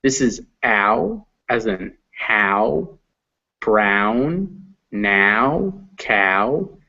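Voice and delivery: ow and how said slowly with a gliding ow; ow diphthong. Shown as a demonstration. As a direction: stretch the ow in how. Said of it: "mixed with the little U".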